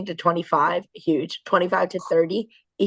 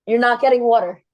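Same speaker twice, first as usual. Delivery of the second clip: firm parent; 3 semitones higher, 6 dB louder than usual. Real, not acted.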